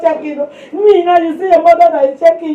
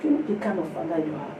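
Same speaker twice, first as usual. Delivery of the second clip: softer, quiet reassuring answer; a hint of sleepy calm; calm, soft reply; 6 semitones lower, 9 dB quieter than usual. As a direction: calmly correct the panicking woman. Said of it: "I said".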